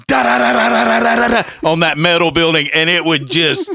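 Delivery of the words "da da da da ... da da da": woodpecker imitation. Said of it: "that little motherfucker would just be".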